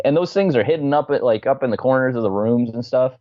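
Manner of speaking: ordinary talk, nothing marked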